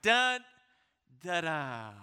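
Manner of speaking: loud dun then falling da da; dum da da cadence. Shown as a demonstration. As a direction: hum the rhythm of the phrase.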